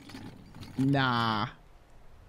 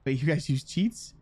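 usual speaking voice

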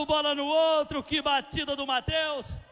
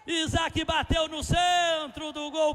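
ordinary talk, nothing marked

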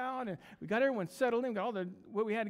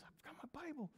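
hushed, higher-pitched repeat; nervous whisper; 5 semitones higher, 17 dB quieter, much faster than usual. Acted. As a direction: whisper it anxiously to your neighbour. Nervous preacher repeats his confession.

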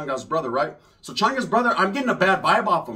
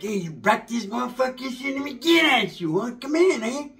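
higher, put-on voice of the cousin; cousin's put-on voice; 6 semitones higher than usual. Cousin inviting them into his house, higher put-on voice. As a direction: play him welcoming you in, voice pitched up. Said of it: "my primo be like".

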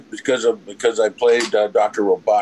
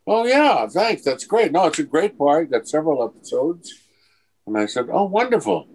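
much higher, gushing thanks; delighted; 7 semitones higher than usual. Retold or imitated. Actor thrilled by the producers' call, gushing and higher. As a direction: bubble over at the good news.

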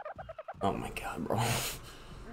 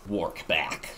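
lower, accidental American-accented aside; 5 semitones lower, 5 dB quieter than usual. Real, not acted. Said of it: "why am I American?".

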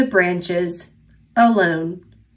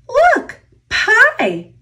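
much higher thrilled cry; excited squeal; 13 semitones higher than usual. A narrator's reading. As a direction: point it out in delight. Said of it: "squealed Birch".

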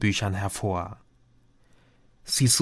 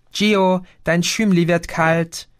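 higher female voice calling from the background; 6 semitones higher, 3 dB louder than usual. A narrator's reading. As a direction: call him from another room. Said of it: "rief eine weibliche Stimme".

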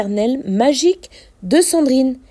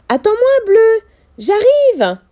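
calling out; 7 semitones higher than usual. Acted. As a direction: call ahead to a friend. Character calls out to whale.

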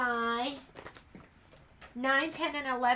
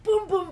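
imitated banging; 7 semitones higher, 8 dB louder, faster than usual. Imitates dog kicking door.

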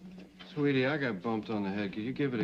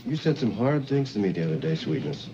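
loud; 8 dB louder than usual. Acted. Flirting man raises his voice.

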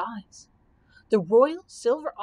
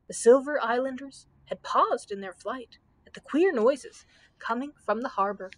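usual speaking voice

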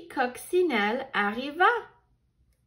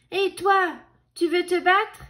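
loud challenge; 6 semitones higher, 4 dB louder than usual. A narrator's reading. Grumpy ladybug challenges wasp.

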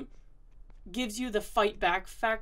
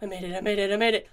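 loud relieved cheering; relieved laughter; 8 dB louder than usual. Real, not acted. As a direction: burst out with relief. Escaped the enemy.

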